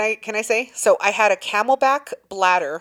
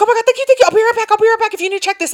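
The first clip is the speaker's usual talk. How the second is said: high, loud breakneck gratitude act; hammed-up relieved thank-you, pitched way up; gushing thanks; 12 semitones higher, 5 dB louder, much faster than usual. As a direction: play the grateful fake-urgent guest. Acting out gushing thanks at the gate.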